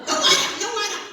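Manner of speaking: high, loud laughing mock refusal; mock manicurist refusing water; mock grouchy manicurist. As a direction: bark no water like the lady. Mimics lady refusing water.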